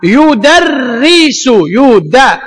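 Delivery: very slow يدرس; teacher spelling out يدرس; slow demo. Shown as a demonstration. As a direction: stretch out يدرس.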